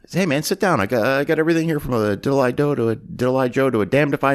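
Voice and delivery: lightly voiced laid-back thug; a hint of Drexel. Drexel invites Clarence to sit, lightly voiced.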